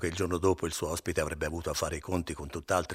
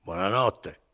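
curt, louder goodnight; rudely; 3 semitones higher, 8 dB louder, slower than usual. Acted. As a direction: toss it off with no courtesy. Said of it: "gli disse sgarbatamente".

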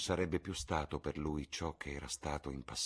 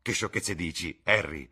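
louder protest, punching the name; exclaiming; 3 semitones higher, 8 dB louder than usual. A narrator's reading. Dorian dismisses Harry's nonsense, exclaiming. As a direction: scoff aloud, landing hard on the name. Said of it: "esclamò il ragazzo".

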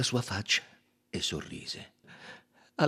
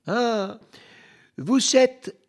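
much higher, louder, slow admiring cry; exclaiming; 8 dB louder, much slower than usual. A narrator's reading. Monsieur Buck crying out in admiration of his friend, excited. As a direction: burst out in delight. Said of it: "gridò".

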